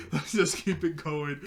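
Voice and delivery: chuckling through the words; laughing. Real, not acted. Storyteller moving the recap on after a joke, laughing. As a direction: carry on while still laughing.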